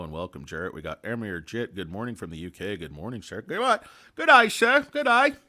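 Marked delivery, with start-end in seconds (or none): 3.4-5.3 high, loud hammed-up British greeting; badly faked UK accent, shouted up high; worst UK accent. Greeting a viewer from the UK, terrible British accent. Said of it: "do my worst, uh, U K accent for you".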